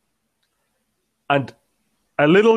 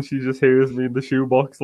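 ordinary talk

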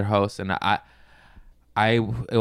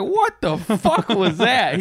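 high, slow mock disbelief; mocking host; 10 semitones higher, 3 dB louder, much slower than usual. Host roasting him on stage, high slow mock disbelief. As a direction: drag it out in disbelief. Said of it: "He's like".

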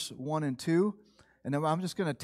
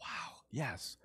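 near-whispered, low falling affirmation; hushed awe; 7 semitones lower, 14 dB quieter than usual. Acted. Preacher answering his own doubt about being invited, hushed awe. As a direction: drop to a whisper in wonder.

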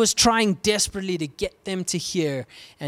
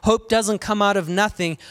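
normal talking voice

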